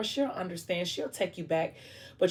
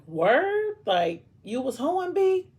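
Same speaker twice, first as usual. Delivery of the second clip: shocked disbelief; 9 semitones higher, 5 dB louder, much slower than usual. Acted. Messiah shocked by her confession.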